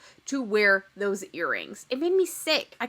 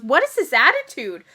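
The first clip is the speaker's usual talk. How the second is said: mock indignant; 8 dB louder than usual. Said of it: "jumps down her throat and goes".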